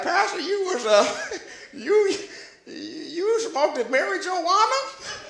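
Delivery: mock disbelief, halting and giggly; shocked congregant. Voicing a shocked congregant questioning him.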